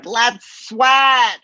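high, bright instructor cheer; peppy fitness instructor. Retold or imitated. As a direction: pump up the class. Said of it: "instructors that are".

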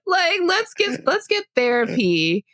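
high, breaking into laughter; laughing. Real, not acted. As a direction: laugh through the words. Laughing at own joke.

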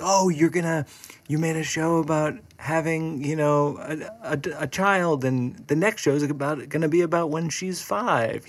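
lightly mocked audience questioner; people's eager assumption. Quotes what people always say.

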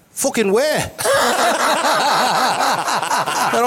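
high, laugh breaking through the quote; can't get the punchline out for laughing; real laughter over the retold retort. Real, not acted.

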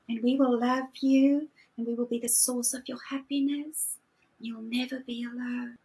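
hushed, half-whispered lure; soft beckoning whisper pulling you in; seductive tribe voice. Retold or imitated. The tribe voice luring her to belong, a hushed coaxing whisper. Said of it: "The tribe voice".

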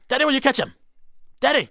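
high-pitched put-on Irish accent; playful, high Irish accent. Shown as a demonstration. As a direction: imitate an Irish son calling out excitedly. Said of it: "I'm giving it an Irish accent".

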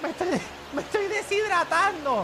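whiny student voice. Acted. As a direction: whine like an overheated kid. Classroom skit, hot student.

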